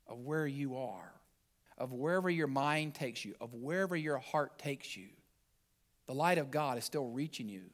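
low, hushed, gentle sermon voice; soft devotional preaching. Acted. Pastor comforting the congregation, hushed and gentle. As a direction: ease them into calm assurance.

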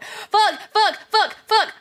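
high, loud, punchy swearing; flustered outburst. Real, not acted. Streamer panicking on air after getting shy, flustered shouting. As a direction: burst out with each curse separately.

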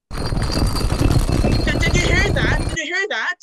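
high, loud, alarmed calls. Acted. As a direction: call out in alarm that you heard something. Hears a strange voice, alarmed.

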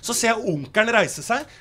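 ordinary talk, nothing marked

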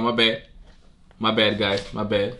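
normal talking voice

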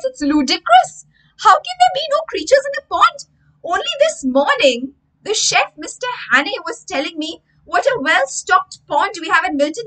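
high, loud, outraged teacher rant; indignant teacher. Acted. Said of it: "And Miss Corbishley says".

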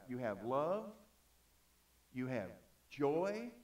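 slow list with long pauses between items; spaced-out, measured reading. Real, not acted. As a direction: read it out deliberately, pausing before each key word. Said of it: "even though I'm reading slow".

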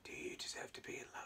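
soft teasing whisper. Real, not acted. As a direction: whisper back with a wink. Vriendin fluistert plagerig terug.

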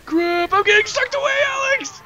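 high, loud cry rising into a yell; panicked shout. Real, not acted. Player being sucked away calls teammate, panicked yelling. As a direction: shout for rescue.

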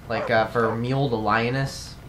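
ordinary delivery

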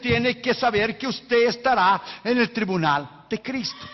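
slow, high chanted warning; solemn preaching. Acted. Preacher warning the congregation of judgment, slow and solemn. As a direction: intone it like a judgment.

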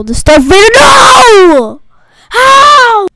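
high anguished shout; raging gamer. Real, not acted. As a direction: scream in disbelief at losing. Eaten in game, cries out.

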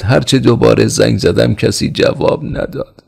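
high, anxious report; frightened servant. A narrator's reading. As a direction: plead that nobody answered. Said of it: "زیر لب گفت".

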